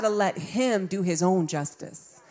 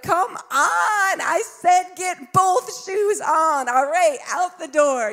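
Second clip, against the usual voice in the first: high, harried mother calling out; exasperated mom yell; 8 semitones higher, 3 dB louder, slower than usual. Acted. Mother rushing late kids to school, exasperated mock yell. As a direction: hurry the kids along, pitched high.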